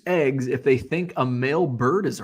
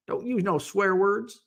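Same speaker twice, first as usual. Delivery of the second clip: higher-pitched mock Southern admonishment; Southern accent; 5 semitones higher than usual. Trying out swear as a Southern word, mock Southern accent. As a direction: lightly put on a Southern twang. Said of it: "If you use a Southern accent".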